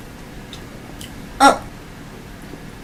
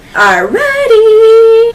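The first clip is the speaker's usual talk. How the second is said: excited; 10 semitones higher, 6 dB louder, much slower than usual. Real, not acted. Mukbang intro hype.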